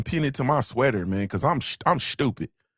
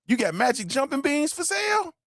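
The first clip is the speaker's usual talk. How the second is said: squeaky, much higher mock question; joking high squeal; 11 semitones higher than usual. Jokey mock pitch to chat.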